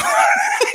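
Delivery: shrieking high laugh; high burst of laughter. Real, not acted. Cracking up at his own pad thai joke, shrieking laugh.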